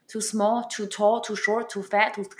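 ordinary talk

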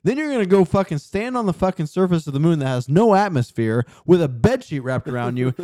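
ordinary talk, nothing marked

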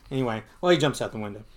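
plain reading voice

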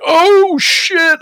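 very high, loud, drawn-out cry; re-enacted shock. Retelling the chapstick reveal, re-enacted shock. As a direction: play up the jolt of a reveal.

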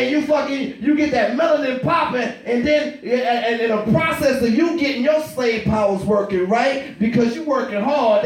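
normal talking voice

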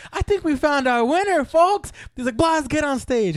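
high-pitched hammed-up show host; excited host. Comedian picking his volunteer from the crowd, excited high announcer voice. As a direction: announce the winner to the whole room.

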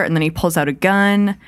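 usual speaking voice

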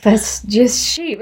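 slow deadpan reply; unimpressed driver. Retold or imitated. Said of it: "And he's just like".

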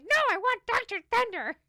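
high, loud mock exasperated insisting; insistent shout. To the soda guys at Walmart, a loud exasperated insisting. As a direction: yell it like you're fed up with them. Retold insisting to store workers.

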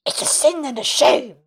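put-on high, hushed old man's hiss. A narrator's reading. Old man grumbling to the women, hissed. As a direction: hiss it like a bitter old villager.

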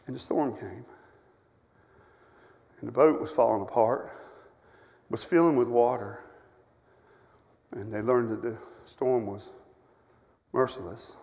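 a preacher dropping to a low story voice; hushed preaching. Acted. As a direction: let the storm loom quietly. Hushed retelling of the storm.

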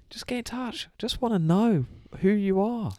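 high mock-desperate plea; mock needy plea. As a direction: beg the listener to write in. Pleading to lone Taiwan listener.

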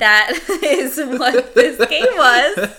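words broken by laughter; laughing. Real, not acted. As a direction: laugh through the sentence. Laughing through the line.

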